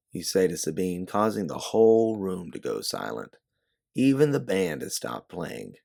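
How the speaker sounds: ordinary delivery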